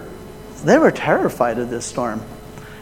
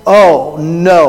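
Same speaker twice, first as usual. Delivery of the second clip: groaning dismay; 6 dB louder than usual. A narrator's reading. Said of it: "and they groaned".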